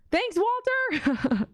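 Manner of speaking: slow, lilting, high-pitched greeting to a viewer; drawn-out delighted thanks. Real, not acted. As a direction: sing the name out to greet him.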